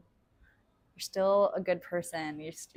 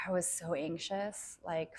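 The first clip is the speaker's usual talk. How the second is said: normal talking voice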